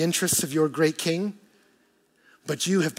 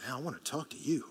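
seductive fish; 3 semitones lower, 11 dB quieter than usual. Pretend.

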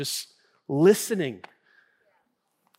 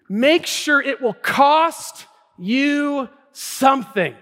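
loud, high, hammering key words; forceful preaching; 10 semitones higher, 7 dB louder than usual. Real, not acted. Pastor urging congregation to fast at a cost, forceful preaching. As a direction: preach it hard, drawing out the weighty words.

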